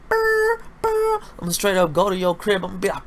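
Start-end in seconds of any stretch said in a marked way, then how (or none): 0.1-1.2 very high rattling brr, twice; imitated gunfire. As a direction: rattle off a mock gunshot sound. Imitating gunfire at purge night.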